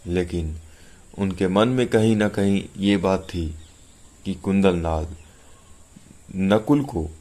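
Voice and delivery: ordinary delivery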